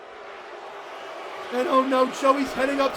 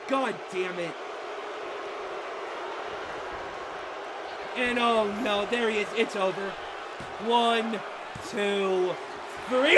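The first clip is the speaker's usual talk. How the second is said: low, soft, resigned delivery; deflated, sinking voice; dejected commentary; 5 semitones lower, 7 dB quieter than usual. Real, not acted. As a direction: call it as if all is lost. Commentator dejected as favourite loses.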